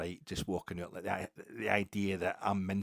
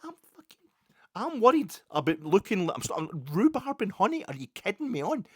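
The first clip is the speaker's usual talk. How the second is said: high-pitched, louder mock disbelief; mock incredulous; 12 semitones higher, 8 dB louder than usual. Visitor reacting to Rhubarb and Honey, mock incredulous. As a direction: play up high, exasperated disbelief. Acted disbelief at trendy bar name.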